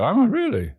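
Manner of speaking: high-pitched questioning comeback; incredulous retort. Acted. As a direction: leap up high in mock disbelief. Acted incredulous reply to the gunman.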